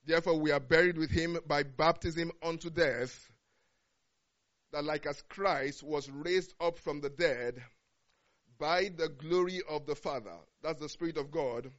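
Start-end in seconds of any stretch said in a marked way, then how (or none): 0.0-10.4 measured, solemn Bible reading; slow, deliberate reading of scripture. Acted. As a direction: read the verses out with weight. Reads Romans chapter six aloud.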